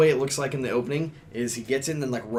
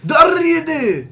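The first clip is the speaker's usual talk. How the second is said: loud high Japanese anime shout; Japanese anime accent; 12 dB louder than usual. Retold or imitated. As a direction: shout the name like an anime hero. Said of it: "say it in the Japanese accent".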